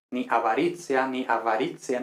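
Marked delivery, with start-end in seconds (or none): 0.3-1.1 "avaricia" said a-ba-RIT-sia; wrong TS sound. Shown as a demonstration. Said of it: "ni nada de eso". 1.3-2.0 "avaricia" said a-va-RIT-sia; "avaricia" with English V and ts; wrong V and TS. Shown as a demonstration. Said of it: "ni nada de eso".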